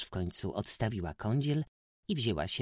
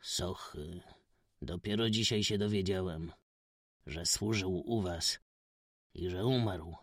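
grieving gentleman. A narrator's reading. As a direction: sigh over a search too late. Stranger voiced low.

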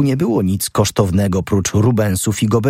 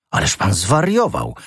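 usual speaking voice

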